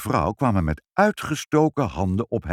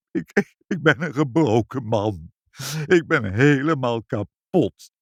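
mildly tearful; 4 semitones higher than usual. A narrator's reading. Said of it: "en barstte in tranen uit".

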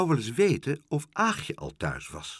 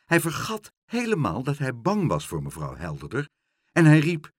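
ordinary delivery

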